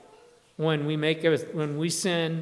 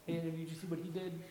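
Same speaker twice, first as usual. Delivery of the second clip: hushed, much softer aside; gossipy undertone; 12 dB quieter than usual. Acted. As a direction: pass it on under your breath like gossip. Acting out hushed gossip about someone.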